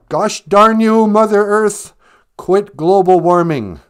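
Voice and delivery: loud, high mock scolding; mock outrage at Mother Earth; mock angry shout. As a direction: shake a fist at Mother Earth, hammed-up.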